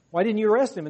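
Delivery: acted authority calling someone to account; indignant demand. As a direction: demand an explanation from underlings. Leaders challenge officers who returned empty-handed.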